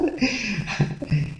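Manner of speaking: high, breathy madman's laugh; mad cackle. Acted. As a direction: cackle at your own cunning. Mad narrator gloating over murder.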